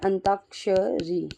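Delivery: Antakshari drawn out syllable by syllable; slow spelled-out word. Shown as a demonstration. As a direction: say antakshari slowly so students catch it. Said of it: "ऐसे लिखा जाता है". (धीरे धीरे अंताक्षरी)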